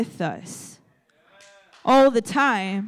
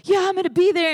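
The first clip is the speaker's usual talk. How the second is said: high, louder mock eager yes; girl telling her friend yes, higher and louder; 8 semitones higher, 5 dB louder, faster than usual. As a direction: jump at the invite, pitched high. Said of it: "she's like".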